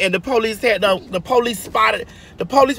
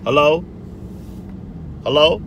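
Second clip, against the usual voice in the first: Timothy Jenner; 5 semitones lower than usual. Retold or imitated.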